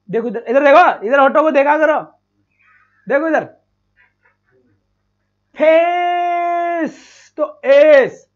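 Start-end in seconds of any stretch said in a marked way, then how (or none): 5.6-8.3 फेस stretched very long, slightly higher; फेस with a long ए glide; exaggerated slow demo. Shown as a demonstration. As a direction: draw out फेस so the lips show the ए. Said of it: "मेरा मुंह देखना".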